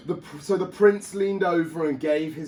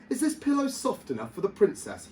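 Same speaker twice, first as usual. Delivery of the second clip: high, fast eager question; eager knight; 9 semitones higher, faster than usual. A narrator's reading. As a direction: ask it brightly like a keen knight. Knight asks horse.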